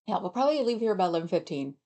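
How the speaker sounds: ordinary talk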